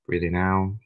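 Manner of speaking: calm meditation leader, long pauses between phrases; guided meditation. Acted. Leader guiding a group through breathing and body relaxation, meditative. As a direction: guide them gently into relaxation, unhurried, leaving room to breathe.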